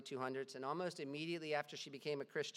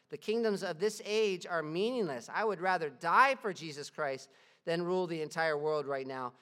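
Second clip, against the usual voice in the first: louder, impassioned reading of a martyr's letter; fervent reading; 5 dB louder than usual. Acted. Preacher reading Ignatius' letter to the church, impassioned. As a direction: proclaim it like a vow.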